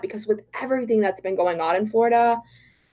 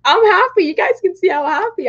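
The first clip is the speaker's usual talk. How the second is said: excited; 10 semitones higher, 4 dB louder than usual. Real, not acted. Celebrating results on stream.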